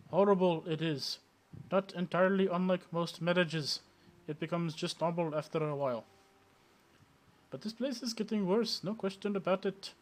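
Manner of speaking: the wistful vendor rambles in a mock Indian accent, then makes his pitch; bad Indian accent. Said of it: "my really bad, uh, Indian accent".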